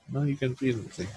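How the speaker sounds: ordinary talk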